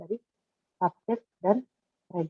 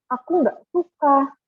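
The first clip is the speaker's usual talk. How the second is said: put-on childlike protest, pitched up and slowed; exclamation; 7 semitones higher, 5 dB louder, slower than usual. Shown as a demonstration. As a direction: exclaim higher and louder, refusing. Contoh kalimat seru. (guru mencontohkan kalimat seru: berseru menolak)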